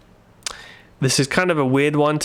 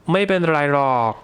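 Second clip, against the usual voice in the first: dragged out; much faster than usual. Shown as a demonstration. Teacher drags out ไม่เป็นไรหรอก to soften the negation. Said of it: "drag that out a little bit".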